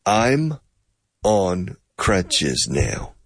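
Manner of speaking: very slow, each sound joined; slow demo of connected speech; slow linked syllables. Shown as a demonstration. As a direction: draw it out to show the linking. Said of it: "you don't understand the syllabic connectivity".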